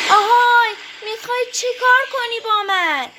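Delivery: high, loud calling out; alarmed shouting. A narrator's reading. Trapped demon inside the jar, shouting to the fisherman. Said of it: "دیو از داخل خمره فریاد زد".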